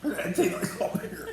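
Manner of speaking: talking through laughter; laughing. Real, not acted. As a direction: giggle through the words. Laughing while tasting.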